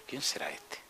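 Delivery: breathy whisper to himself; whispered aside. Retold or imitated. Pastor asking the Lord quietly who the young man is, whispered. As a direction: wonder under your breath who it is. Praying to himself, unsure of stranger.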